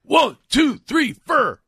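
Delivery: high, loud count with "four" as "fur"; exaggerated high-pitched rocker count-off; mock rock count-in. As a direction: count the band in, hammed-up.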